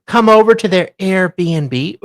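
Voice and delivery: usual speaking voice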